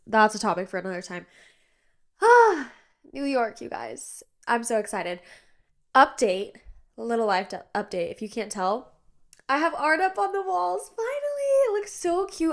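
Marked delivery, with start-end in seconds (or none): 2.2-2.7 high delighted squeal; a burst of glee, pitched way up; excited squeal. Real, not acted. Podcaster squealing about her New York trip. 9.5-12.5 high, gushing delight. Real, not acted. Host gushing to listeners over new wall art.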